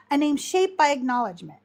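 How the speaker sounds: normal talking voice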